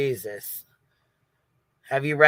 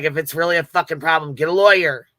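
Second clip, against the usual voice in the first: imitating fed-up husband; 3 semitones higher, 5 dB louder than usual. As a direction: mimic a husband laying down an ultimatum. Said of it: "He's not fucking happy at all".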